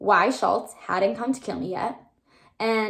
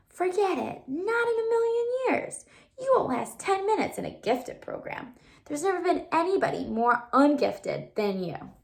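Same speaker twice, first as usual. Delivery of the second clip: high, thin little inner voice; tiny inner voice; 7 semitones higher than usual. A narrator's reading. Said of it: "A tiny voice spoke up".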